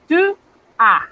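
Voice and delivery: Tu with lips tightly rounded, then a without the S; French tu as. Shown as a demonstration. Teacher showing tu as to students, closed U. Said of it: "estoy pronunciando solo la A".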